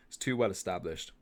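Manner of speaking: normal talking voice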